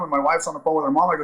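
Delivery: normal talking voice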